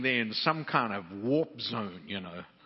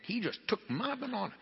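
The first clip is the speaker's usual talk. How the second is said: much higher, in amused disbelief; amazed retelling; 12 semitones higher, faster than usual. Retold or imitated. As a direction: squeak it out like you can't believe it.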